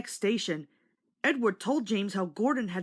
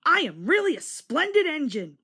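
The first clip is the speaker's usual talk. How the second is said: higher, louder self-satisfied boast; proud boast; 7 semitones higher, 5 dB louder than usual. A narrator's reading. James admiring himself on the platform, proud and loud. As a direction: puff up with pride. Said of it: "he thought".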